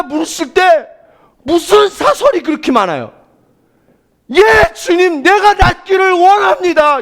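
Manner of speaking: loud, high, impassioned sermon delivery; full-force preaching. Real, not acted. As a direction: drive each phrase out loudly to the congregation.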